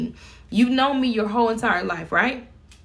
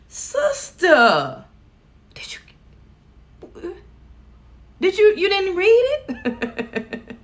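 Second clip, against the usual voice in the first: incredulous; 10 semitones higher, much slower than usual. Retold or imitated. Exasperated at coworker ignoring notes.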